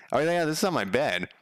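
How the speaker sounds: usual speaking voice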